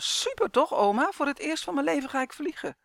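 high-pitched, thrilled outburst; beaming excitement. A narrator's reading. Boy telling grandma about his first flight, overjoyed. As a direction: gush with delight, voice leaping up. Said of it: "Roy begon te stralen".

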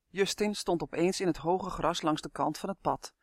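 plain reading voice

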